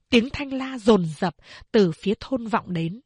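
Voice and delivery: ordinary delivery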